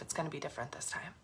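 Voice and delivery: soft, fast vow under the breath; quiet self-promise; quiet promise to herself. Real, not acted. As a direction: murmur it to yourself as a private promise. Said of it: "making a promise to myself".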